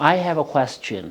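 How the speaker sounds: "question" with a clear kw-es-chun; correct pronunciation. Shown as a demonstration. Teacher modeling the right pronunciation of question. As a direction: say "question" carefully, not like caution. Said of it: "That is the right pronunciation".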